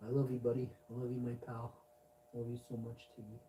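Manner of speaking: soft, tender murmur to the cat. Real, not acted. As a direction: whisper love to your pet.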